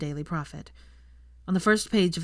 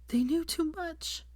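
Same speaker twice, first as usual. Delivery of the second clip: tearful; 11 semitones higher, 5 dB quieter, slower than usual. A narrator's reading. Crying confession.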